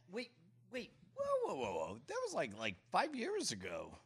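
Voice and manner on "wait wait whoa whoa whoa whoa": surprised. Real, not acted. Sudden surprise at video date.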